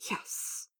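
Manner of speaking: hushed yes through gritted teeth; tight, strained admission. A narrator's reading. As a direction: force it out quietly through clenched teeth. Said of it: "Dorian gritted".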